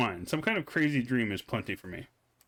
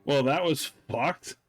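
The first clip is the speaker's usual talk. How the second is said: usual speaking voice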